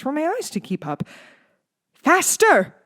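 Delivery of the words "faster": high, loud, thrilled shout; gleeful manic shout; excited cry. A narrator's reading. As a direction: cheer him on wildly. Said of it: "Doctor Shriek cried excitedly".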